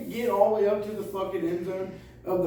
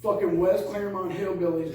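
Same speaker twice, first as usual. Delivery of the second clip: usual speaking voice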